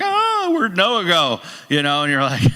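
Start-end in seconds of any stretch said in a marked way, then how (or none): 0.0-1.4 high, slow, drawn-out panic; worried parent. Retold or imitated. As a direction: stretch it out like a parent who lost a kid. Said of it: "we're like".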